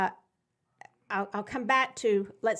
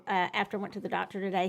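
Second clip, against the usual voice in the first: usual speaking voice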